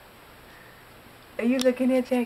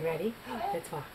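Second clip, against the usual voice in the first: ordinary talk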